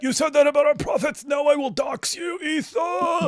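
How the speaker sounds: high, loud hammed-up yelling in a put-on voice; mock outraged shout. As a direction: ham up an outraged fan threatening to dox.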